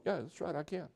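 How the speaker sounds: satisfied man nodding under his breath; quiet self-assurance. Retold or imitated. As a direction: mumble it as you walk away. Man walks off reassured.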